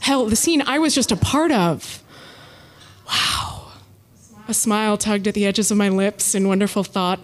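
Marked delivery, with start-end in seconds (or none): 3.1-3.7 whispered wow. Real, not acted. Unvoiced awed reaction.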